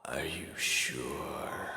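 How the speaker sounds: put-on hushed and weary, like something old from the earth; slow whispered otherworldly voice; ancient spirit voice. Toll answers the pony.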